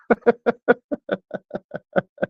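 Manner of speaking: cracking up at the end of the story; real burst of laughter. Real, not acted. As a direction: laugh hard at the absurd story. Punchline of own story.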